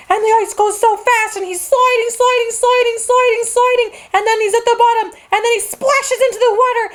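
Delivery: put-on gleeful kid narrating a slide; excited storytelling. A narrator's reading. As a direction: tell it like the ride is thrilling. Excited retelling of sliding polar bear.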